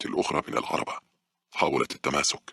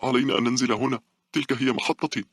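put-on high, urgent child outburst; sudden boy's cry; 11 semitones higher, 4 dB louder than usual. A narrator's reading. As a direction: cry out suddenly as a panicked boy. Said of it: "فجأة صرخ الفتى".

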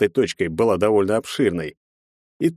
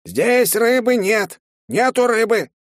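put-on high, slow wailing old man; shrill-free high cry, drawn out; old man shouting; 4 dB louder, slower than usual. A narrator's reading. Old man yells no fish.

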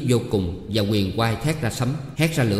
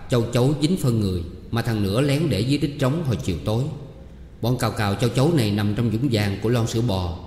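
ordinary delivery, nothing marked

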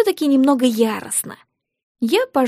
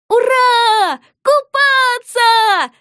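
much higher, loud gleeful yell; shouting; 12 semitones higher, 5 dB louder, much slower than usual. Acted. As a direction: kids yelling with joy. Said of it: "заорали мы". (радостно заорав)

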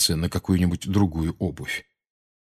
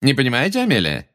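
smiling mockery; 6 semitones higher, faster than usual. A narrator's reading. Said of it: "Фадж по-прежнему улыбался".

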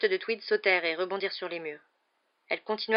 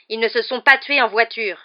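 loud, raised, indignant protest; interruption indignée; 4 semitones higher, 11 dB louder than usual. A narrator's reading. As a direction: cut her off angrily. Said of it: "l'interrompit Harry qui s'était levé d'un bond". (protestation forte et indignée)

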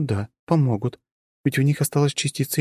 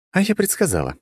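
playful, higher coquettish purr; 9 semitones higher than usual. Acted. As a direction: tease him playfully. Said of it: "кокетливо промурлыкала Жанна".

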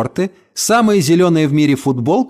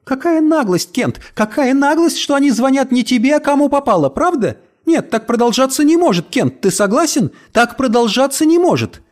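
high, breathless indignant chatter; rattling nonstop; 10 semitones higher than usual. A narrator's reading. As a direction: gabble on without stopping. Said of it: "тараторила без остановки".

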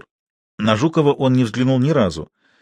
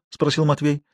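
usual speaking voice

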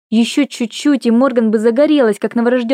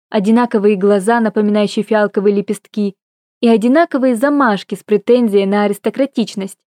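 ordinary delivery

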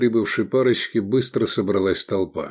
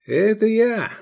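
much higher, thin little voice; unexpectedly thin voice; 12 semitones higher than usual. A narrator's reading. Gloomy man owns up to being the one, in a thin high voice. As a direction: pitch it up into a surprisingly thin tone. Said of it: "неожиданно тонким голосом".

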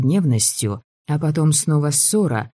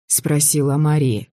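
ordinary delivery, nothing marked

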